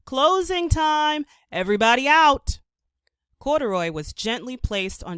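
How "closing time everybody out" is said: loud, high call across the room. A narrator's reading. Manager calling out to the whole laundromat, loud. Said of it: "the manager of the laundromat called".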